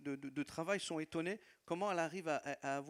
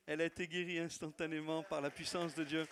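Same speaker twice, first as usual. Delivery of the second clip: normal talking voice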